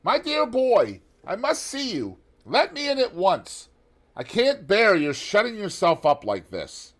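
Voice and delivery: high, loud voice of a languid gentleman; Lord Henry. A narrator's reading. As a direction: pitch it well up and call through the door, coaxing. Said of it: "he heard Lord Henry's voice outside".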